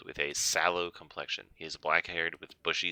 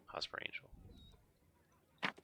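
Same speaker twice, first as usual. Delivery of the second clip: breathy, drawn-out whisper of the name; whispered signature; 14 dB quieter, much slower than usual. Acted. Reading the letter's signature to the listeners, whispered slowly. Signing off a read love letter.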